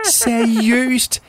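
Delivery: high, mocking girl voice; cheeky kid teasing.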